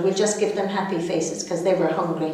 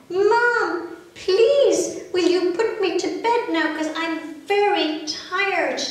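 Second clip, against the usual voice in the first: put-on high, loud sleepy baby asking; a tired child pleading with mum, pitched high; 9 semitones higher, 3 dB louder, slower than usual. Baby asks for bed.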